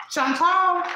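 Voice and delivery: sharp call to the pet. Real, not acted. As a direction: call her name up high like catching a pet in the act. Calling out to her pet.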